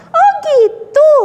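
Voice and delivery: put-on high, slow wondering child; puppet girl Mimi. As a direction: marvel like a little girl. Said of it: "Iya, Mimi".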